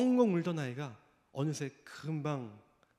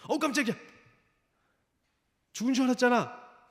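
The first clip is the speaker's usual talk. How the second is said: mock startled; 8 semitones higher, 4 dB louder than usual. Mock startled Jesus, hypothetical.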